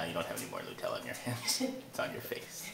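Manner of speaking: whispering. Real, not acted. Teasing child about Nutella.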